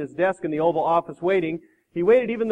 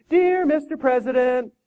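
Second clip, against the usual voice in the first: high, drawn-out nasal singsong; nasal sing-song; 9 semitones higher, 5 dB louder, slower than usual. Acted. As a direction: recite sweetly through the nose, slowly. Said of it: "in a nasal, sing-songy voice".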